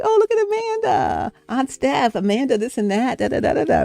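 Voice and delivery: put-on high cooing baby talk over a doll. Family cooing at niece's doll, high doting baby talk. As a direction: coo like grown-ups fussing over a toddler's toy.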